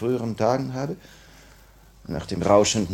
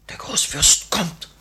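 slow, tense whisper; urgent whisper; 8 semitones higher, 4 dB louder, slower than usual. Acted. Annenkov whispering to Dora, urgent. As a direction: hiss the warning urgently. The Grand Duke approaches.

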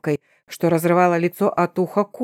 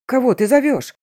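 yelled high and loud in terror; panicked yell; 6 semitones higher, 3 dB louder than usual. A narrator's reading. Terrified man yelling at the werewolf, high panicked yell. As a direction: scream it from the corner. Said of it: "истошно заорал Кирилл".

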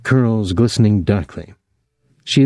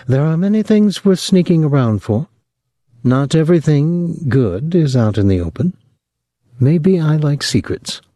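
Clotilde's voice; 7 semitones higher than usual. Acted. Clotilde's voice held higher.

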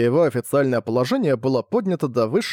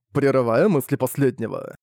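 ordinary delivery

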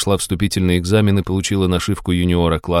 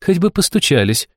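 higher, snappy comeback; cheeky retort; 10 semitones higher than usual. Acted. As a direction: throw it right back. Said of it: "вернула ей Алиса".

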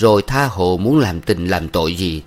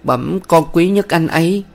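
put-on higher, timid girl pleading; 10 semitones higher, 4 dB louder than usual. A narrator's reading. Thanh pleading with the village head's wife, higher timid voice. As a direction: beg humbly, like a frightened young woman.

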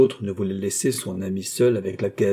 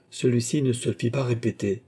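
plain reading voice

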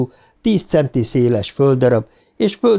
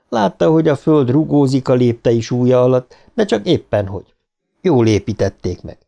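plain reading voice

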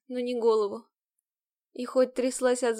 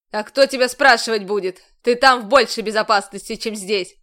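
angry shout; 10 dB louder than usual. A narrator's reading. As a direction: overrule her at full volume. Commander shouting at her.

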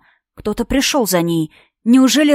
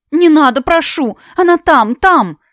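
higher, louder desperate pleading; desperate cry; 6 semitones higher, 5 dB louder than usual. Acted. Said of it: "отчаянный возглас".

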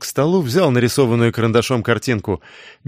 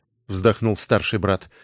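ordinary delivery

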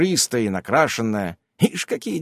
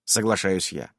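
plain reading voice

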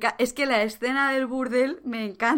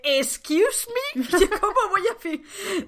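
laughing through a high scandalised "excuse me"; mock prim Caitlyn; 9 semitones higher than usual. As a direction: play the shocked prim girl, pitched way up. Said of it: "Y ella dice".

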